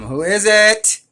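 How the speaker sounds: loud, high call through a closed door; a loud high call toward the front door. Retold or imitated. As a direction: shout it out to whoever is outside. Calling out to a visitor at the door.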